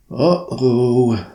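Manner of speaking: worried uh-oh. Acted. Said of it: "Thompson muttered".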